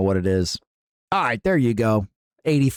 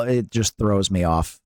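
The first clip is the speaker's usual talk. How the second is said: ordinary talk, nothing marked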